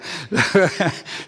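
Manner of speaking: brief amused chuckle; a quick real laugh at the joke; short laugh. Real, not acted. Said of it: "I can see our accountant smiling".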